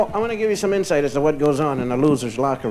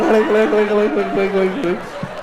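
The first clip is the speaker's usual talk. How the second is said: giggling laugh; 3 semitones higher than usual. Real, not acted. Laugh without words.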